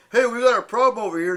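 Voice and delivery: quick, high, loud retold call. Security calls over actors in police uniforms, calling out. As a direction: imitate an urgent call for backup.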